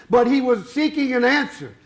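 ordinary talk, nothing marked

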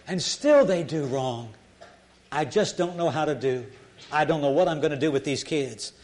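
normal talking voice

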